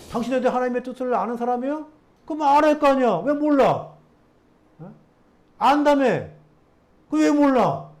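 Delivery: high, loud mocking jab; sarcastic taunt. The healed man mocking the Pharisees, loud and sarcastic. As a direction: throw the sarcasm right back at them. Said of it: "이 비꼬는 말이에요".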